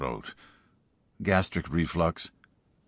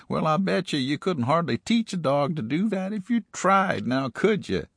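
put-on folksy old-timer voice; 7 semitones higher, 4 dB louder than usual. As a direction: stretch it out like an amused country codger. Character voice in narrated story.

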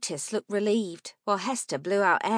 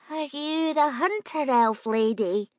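lightly voiced little girl, a faint wobble; a hint of a nervous child asking; 6 semitones higher than usual. A narrator's reading. Young girl at the door asks the stranger, faint wobble. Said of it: "with a faint audible wobble".

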